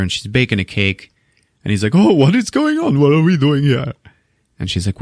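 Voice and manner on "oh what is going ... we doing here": high-pitched mock surprise; pleasantly surprised. Husband discovering the dinner she made, acted surprised. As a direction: imitate a man delighted by a surprise.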